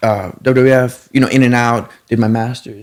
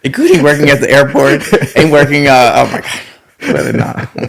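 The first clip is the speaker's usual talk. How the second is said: laughing; 3 semitones higher, 5 dB louder than usual. Real, not acted. Laughing about many odd jobs.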